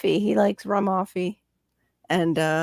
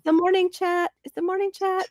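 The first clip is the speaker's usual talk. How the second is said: high mock voice. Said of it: "I'm like".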